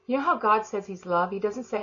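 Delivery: ordinary talk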